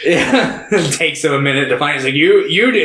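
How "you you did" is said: put-on higher, louder accusing drunk; mock accusing drunk. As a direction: point him out like a drunk. Imitating drunk samurai accusing.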